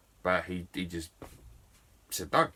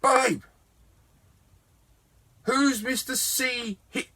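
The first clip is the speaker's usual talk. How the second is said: high, loud call to a partner upstairs; shouting up to someone in another room; retold shout; 6 dB louder, slower than usual. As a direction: holler through the house for your wife. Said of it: "So I shout".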